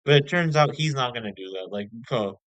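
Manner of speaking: ordinary talk, nothing marked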